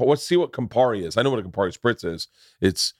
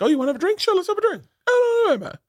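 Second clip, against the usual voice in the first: high, put-on overly welcoming voice; mock eager host; faster than usual. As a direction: caricature your too-friendly self. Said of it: "I'm just so welcoming".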